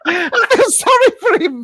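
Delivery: high-pitched words squeezed through laughter; laughing. Real, not acted. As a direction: try to talk while cracking up. Cracking up while mocking Charlie.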